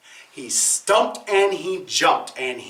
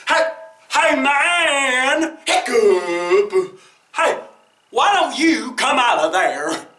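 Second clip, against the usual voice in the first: calling out; 6 semitones higher, 3 dB louder, slower than usual. Acted. Whale calling man.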